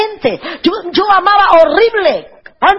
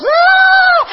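sudden high wailing cry of grief; retold wailing scream; 14 semitones higher than usual. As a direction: let out a long high wail as if leaving loved ones. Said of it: "iba pegando gritos por ellos". (grito agudo de llanto imitado)

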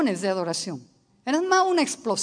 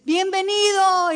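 higher, louder call of welcome; retold crowd welcome; 8 semitones higher, 6 dB louder than usual. Preacher recalling the airport crowd, mock cheering welcome. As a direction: shout the welcome like the crowd did. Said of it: "todo el mundo empieza a gritar".

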